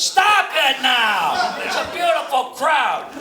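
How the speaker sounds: loud, slow reprimand; scolding shout. Acted. Ventriloquist to puppet insulting the audience, scolding shout. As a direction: shout it slowly to defend the crowd.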